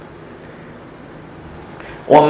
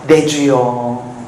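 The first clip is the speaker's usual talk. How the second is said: humble plea; 5 semitones lower, much slower than usual. Acted. Hannah answers humbly.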